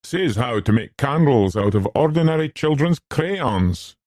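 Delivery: determinedly entertaining announcement; valiantly entertaining. A narrator's reading. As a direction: try hard to interest a bored listener. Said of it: "in the manner of one endeavouring valiantly to entertain".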